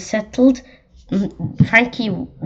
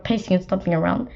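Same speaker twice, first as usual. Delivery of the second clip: ordinary delivery, nothing marked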